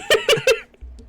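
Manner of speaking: high-pitched burst of laughing; real laughter. Real, not acted. Laughing over weird interpretations between languages, real amused cackle. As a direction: laugh out loud, high and helpless.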